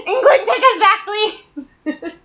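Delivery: high, loud begging through laughter; hammed-up high wail, laughing; laughing mock plea. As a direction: plead jokingly while cracking up.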